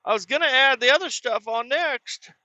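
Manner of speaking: high, pleading cook voice; high whiny apology. Retold or imitated. Cook apologizing to angry customer, high pleading whine. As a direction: beg forgiveness in a high squeak.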